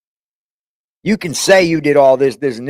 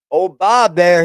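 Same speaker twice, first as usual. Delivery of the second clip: normal talking voice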